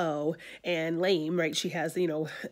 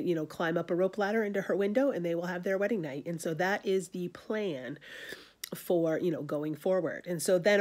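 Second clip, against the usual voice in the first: normal talking voice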